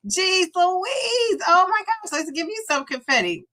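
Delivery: high, thrilled exclamation; excited squeal. Real, not acted. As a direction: burst out in delight at her news. Celebrating a viewer's milestone.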